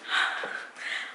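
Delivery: heavy sigh. Real, not acted. Audible sigh of frustration.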